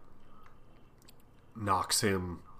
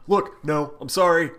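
higher, louder apologetic backpedaling; mock frantic pleading; 6 semitones higher, 7 dB louder than usual. Podcaster voicing Samson begging off the Hulk, mock frantic pleading. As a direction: protest your apology in a raised voice.

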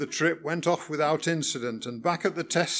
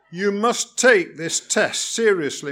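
stern; 5 semitones higher, 7 dB louder than usual. Acted. Examiner scolds the learner driver.

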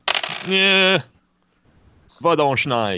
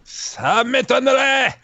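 high, slow, drawn-out disbelief; sarcastic; 11 semitones higher, 3 dB louder, slower than usual. Real, not acted. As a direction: scoff at the idea playfully. Mocking the Santa guess.

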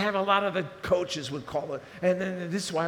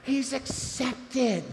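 ordinary delivery

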